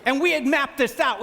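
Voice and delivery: ordinary talk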